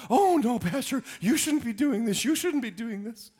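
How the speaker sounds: high, fluttery protest; fussing church member. Retold or imitated. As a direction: gush like a flustered member stopping the pastor. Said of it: "people will come".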